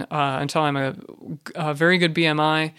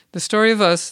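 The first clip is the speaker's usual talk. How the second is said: higher and louder, proclaiming the title; announced; 6 semitones higher, 4 dB louder than usual. Real, not acted. Host announces the episode's song to listeners, proclaimed. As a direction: present it like a show host.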